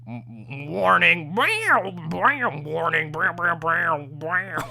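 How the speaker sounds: chanted siren and beat noises; beatbox the alarm entrance; imitating the RTC siren theme; imitating siren music.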